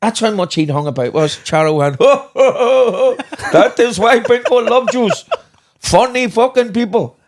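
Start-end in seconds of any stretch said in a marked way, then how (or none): 2.0-7.2 high, loud, slow Bulgarian laughter and lines; hearty laughing Bulgarian host; Bulgarian accent. Retold or imitated. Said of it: "like in a Bulgarian".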